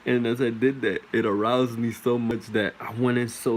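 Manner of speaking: usual speaking voice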